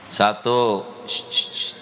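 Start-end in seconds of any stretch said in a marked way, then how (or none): none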